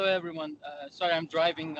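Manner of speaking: ordinary delivery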